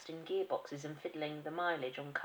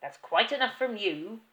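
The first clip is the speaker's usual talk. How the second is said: loud angry mother; the mother silencing her daughter; sharp rebuke; 4 semitones higher, 11 dB louder than usual. Acted. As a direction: snap at the child. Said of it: "Missus Wormwood".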